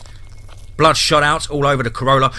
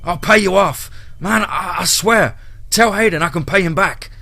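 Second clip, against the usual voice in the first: higher, louder frantic begging; fearful pleading; 8 semitones higher, 5 dB louder than usual. Acted. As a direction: beg for your life, voice climbing. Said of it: "Full of fear".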